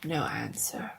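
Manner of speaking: ordinary delivery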